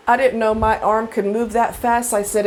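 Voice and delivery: ordinary talk, nothing marked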